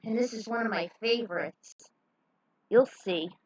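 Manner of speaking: usual speaking voice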